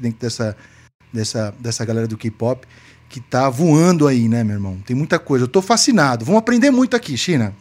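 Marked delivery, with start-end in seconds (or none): 5.5-7.2 high, eager burst of enthusiasm; excited. Real, not acted. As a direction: gush about it, pitch way up. Guest gushes about K-pop.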